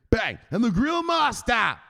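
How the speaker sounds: high, drawn-out announcer shout; hype shout. Pretend. Hyping the grill master to the crowd, loud drawn-out shout. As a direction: belt it out like a ring announcer.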